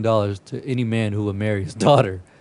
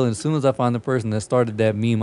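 ordinary talk

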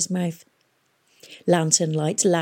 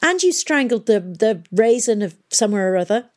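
angry accusation; 4 semitones higher, 4 dB louder than usual. Acted. Tom keeps accusing.

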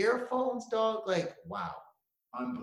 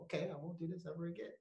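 meek promise; 15 dB quieter, faster than usual. Retold or imitated. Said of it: "I was like".

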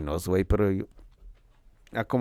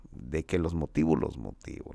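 trailing off; 7 semitones lower, 6 dB quieter than usual. Real, not acted. Se va apagando.

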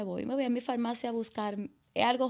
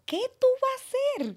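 much higher and louder, alarmed; panicked self-question; 6 dB louder than usual. Retold or imitated. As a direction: blurt it out in sudden panic. Panicked self-talk about insurance. (la madre, alarmada, se pregunta en pánico, mucho más agudo y fuerte)

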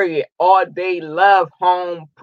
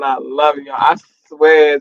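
normal talking voice

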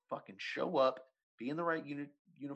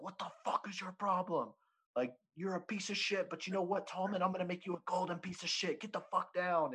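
higher-pitched mock drill sergeant chewing out a recruit; imitated drill sergeant; 5 semitones higher than usual. Drill sergeant to laughing recruit at boot camp, imitated berating. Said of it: "drill sergeant comes up to me".

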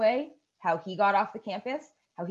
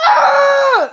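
high loud imitated panic scream; panicked scream; 16 dB louder than usual. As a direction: shriek it, laughing at the panic. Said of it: "He's like".

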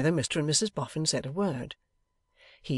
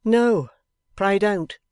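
loud, raised-voice objection; firm interjection; 6 semitones higher, 10 dB louder, much slower than usual. A narrator's reading. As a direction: cut in emphatically to stop her. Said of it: "Mister Boffin interposed".

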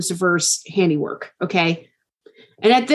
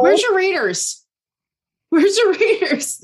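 teasing laugh; 10 semitones higher than usual. Real, not acted.

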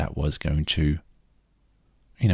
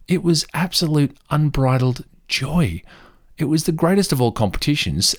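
gushing joy; 9 semitones higher, 4 dB louder than usual. Real, not acted. Gushing about catfish competition.

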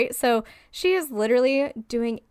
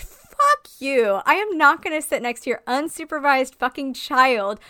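indignant outburst; 4 semitones higher, 4 dB louder than usual. Acted. Imagined passenger refusing to sit by the child, indignant. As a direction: let loose with exaggerated outrage.

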